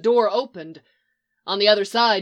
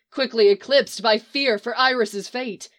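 ordinary delivery, nothing marked

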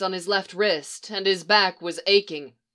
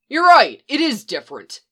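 caustic; 4 semitones higher, 8 dB louder than usual. A narrator's reading.

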